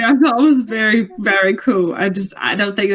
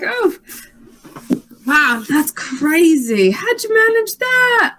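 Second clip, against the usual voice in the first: high, slow mock-impressed voice; high fake amazement; 6 semitones higher, slower than usual. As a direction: overdo the wonder, pitched way up.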